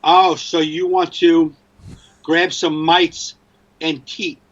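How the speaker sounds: very slow, word by word; mock phone readback. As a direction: read back the garbled text deliberately. Acts phone mishearing, read back slowly.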